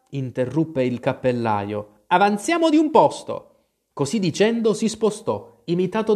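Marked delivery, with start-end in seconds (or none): 2.1-3.5 high, louder command; insistent storybook announcement; bossy Hatter. A narrator's reading. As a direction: order everyone along in a raised voice. Hatter orders everyone to move.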